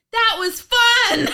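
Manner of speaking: high, loud, drawn-out mock glee; hammed-up cheery shout; gleeful Sally quote. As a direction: crow it like an oblivious thrill-seeker. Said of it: "Sally turns to Joe and said".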